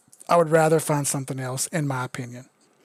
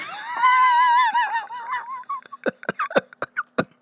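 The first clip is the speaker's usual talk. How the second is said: helpless high-pitched laughing fit; real crying laughter. Real, not acted. Streamer cracking up at Goomba's nut sack joke, crying laughing. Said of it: "I'm over here fucking crying, laughing".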